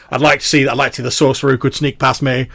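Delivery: loud, high boastful bluster; animated veteran. Acted. Old soldier boasts to comrades about catching sorcerers, animated. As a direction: brag loudly, daring anyone to try. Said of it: "starts to get, like, a little bit animated".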